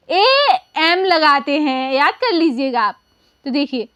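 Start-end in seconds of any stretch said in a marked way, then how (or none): 0.1-1.9 drawn out, letter by letter; slow spelling answer. Shown as a demonstration. Teacher slowly spelling the answer to her question. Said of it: "अम के लिए हम English में क्या लगाते हैं".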